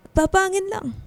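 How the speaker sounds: cough, then force the words out. Acted. Sick mother brushing off her daughter, coughing while she talks. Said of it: "Inuubo na kayo".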